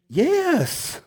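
put-on high, drawn-out yes of a pestered kid; insistent child. Daughter answering dad's repeated question, exasperated.

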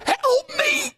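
a high, strained cry of panic; desperate cry for help. Retold or imitated. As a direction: beg for your life as you choke. Choking patient grabs surgeon's tie.

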